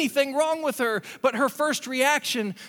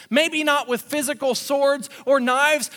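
normal talking voice

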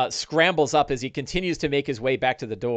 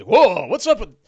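high, loud startled orc yelp; loud high whoa of a spooked orc; 7 semitones higher, 7 dB louder than usual. Acted. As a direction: orc caught off guard by crackling eyes.

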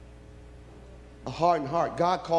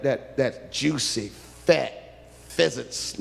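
usual speaking voice

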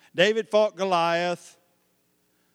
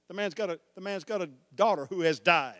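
usual speaking voice

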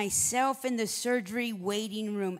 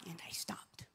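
hushed whisper, much softer; 5 semitones lower, 17 dB quieter than usual. Retold or imitated. Preacher retells the surgeon stopping, in a whisper. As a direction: drop to a whisper for the moment. Surgeon halts mid-operation.

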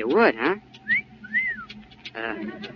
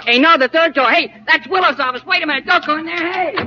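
calling after her; 10 semitones higher than usual. Acted. Receptionist calls after the visitor heading into the wrong office, rising alarmed calls. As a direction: raise your voice higher and higher to stop her going in.

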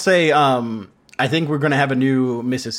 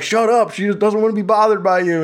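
mock indignant telling-off, higher; retold angry telling-off; 7 semitones higher than usual. Other guy joins in to defend the lady, mock indignant. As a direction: play at snapping to someone's defence. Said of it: "this other guy joined and was like".

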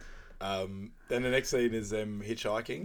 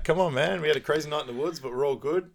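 higher, louder coaxing plea; hammed-up pleading to win a friend over; acted pleading; 6 semitones higher, 6 dB louder than usual. Acting out a pleading character.

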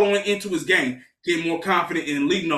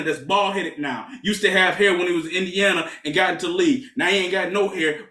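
ordinary talk

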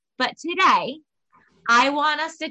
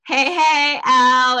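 phone greeting; 2 semitones higher, 7 dB louder, slower than usual. Retold or imitated. Host re-enacting answering her friend's call, loud phone greeting. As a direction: sing out a big greeting, drawn out. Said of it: "I answered the phone like".

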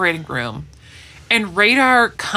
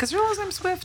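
puzzled; 12 semitones higher, 9 dB quieter than usual. Retold or imitated. Puzzled self-quote.

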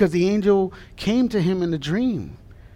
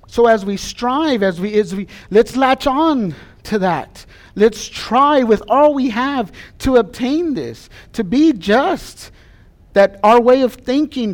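preaching; 4 semitones higher, 4 dB louder than usual. Acted. Pastor urging his congregation to hold on, preaching. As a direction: urge the congregation on with full force.

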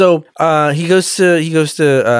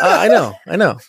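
higher-pitched mock-emotional reply; 3 semitones higher than usual. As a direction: play it lightly choked up, half joking. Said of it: "He gets a little emotional".

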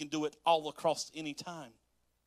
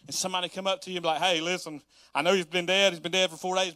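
loud; 2 semitones higher, 8 dB louder, faster than usual. Acted. Imagined friend announcing resurrection.

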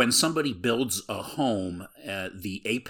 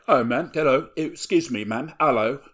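mocking kid; 6 semitones higher, 7 dB louder than usual. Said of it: "some kid who's like".

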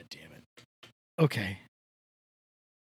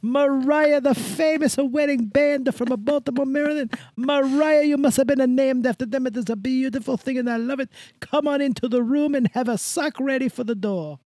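Italian host voice; 6 semitones higher, 3 dB louder than usual. Acted. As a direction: play a gushing Italian greeter. Host character greeting new members.

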